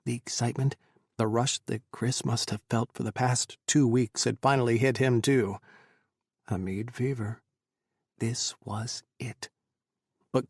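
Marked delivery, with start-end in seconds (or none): none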